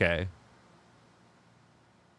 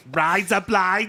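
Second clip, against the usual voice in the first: slow, higher, exaggerated Australian accent; 4 semitones higher, slower than usual. Shown as a demonstration. Bandmate overdoing the coached phrase, thick Australian accent. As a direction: overdo the Australian vowels, drawn out. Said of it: "You don't put an accent".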